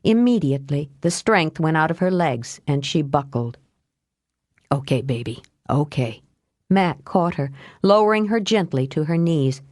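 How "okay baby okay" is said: low, softened murmur of comfort; calm her tenderly as you hold her; gentle man's reassurance in a lowered voice; soothing. A narrator's reading. Mac soothes as he catches her.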